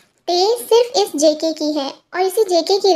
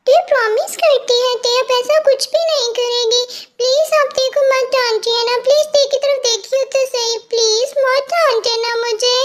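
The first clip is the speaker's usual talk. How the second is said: put-on higher, sweet girlish voice with a nervous giggle; cutesy pleading girl; 4 semitones higher than usual. Girl begs boyfriend not to be angry, cutesy and pleading. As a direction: coax him like a guilty little girl.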